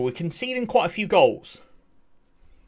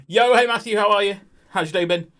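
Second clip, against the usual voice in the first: louder, higher hey to a viewer; excited shout-out; 6 semitones higher, 5 dB louder than usual. Real, not acted. Streamer greets viewer Matthew, loud excited shout-out. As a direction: call out his name like spotting a friend.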